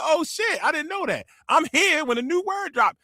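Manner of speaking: high, thrilled surprise; high excited surprise. Retold or imitated. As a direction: gush like you just found out. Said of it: "I said".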